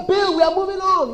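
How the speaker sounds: high-pitched leader's call; high, ringing command; rallying cry. Acted. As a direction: cry out the order to the team. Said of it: "You must hear me cry".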